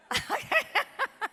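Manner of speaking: real high-pitched, loud burst of laughter; high cackle. Real, not acted. Laughs at own blunt remark.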